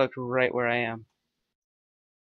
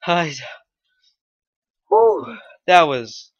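breathless relief; 4 semitones higher, 8 dB louder than usual. Real, not acted. Quest completed.

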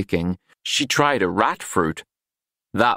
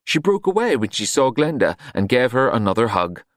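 ordinary delivery, nothing marked

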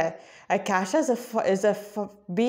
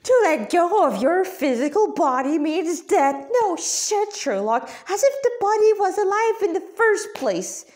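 mock his line, then sneer; scathing higher rant, mocking the guard; scornful rant; 7 semitones higher than usual. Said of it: "Radha spat".

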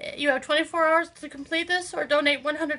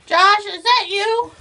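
raised, slow call into the dark; calling out nervously; 6 semitones higher, 8 dB louder, slower than usual. Acted. Alone in a basement, calling for Josh, nervous raised call. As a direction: call out to whoever's hiding, uneasy.

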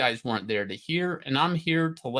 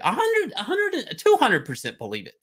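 fired-up outburst; 6 semitones higher than usual. Real, not acted. Hyping his comic-book conviction to chat, in a high excited burst. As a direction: pitch jumps far higher on each hundred. Escalating certainty in rant.

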